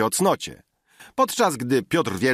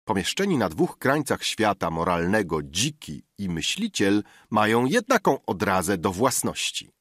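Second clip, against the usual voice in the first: ordinary delivery, nothing marked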